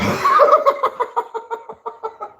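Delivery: bursting into a very high laugh; big high laugh. Real, not acted. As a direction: crack up hard at your own story. Cracks up at his own story.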